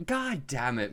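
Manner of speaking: ordinary delivery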